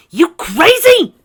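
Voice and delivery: very high, loud and slow; high slow shocked cry; shocked shriek. Acted. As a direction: screech at the archer. Arrow nails robe, shout.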